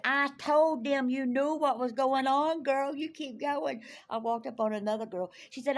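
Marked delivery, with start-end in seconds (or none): 0.0-3.8 loud, high, drawn-out hollering; big excited shout of support; cheering someone on. Retold or imitated. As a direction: imitate a woman whooping encouragement. Acts out a woman cheering her on.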